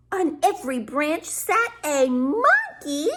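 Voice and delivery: plain reading voice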